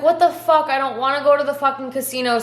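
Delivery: put-on high, exasperated retelling of a drunk protest; annoyed outburst. Drunk, refusing to go to casino.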